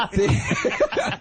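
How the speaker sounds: squealed through laughter; cracking up on the word; high laughing squeal. Real, not acted. Breaks up laughing.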